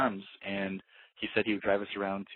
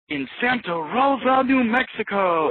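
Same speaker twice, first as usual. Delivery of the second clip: much higher, loud, slow booming radio announcer impression; 14 semitones higher, 7 dB louder, slower than usual. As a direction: announce the town like a hammy radio spot. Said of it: "the radio personality voice".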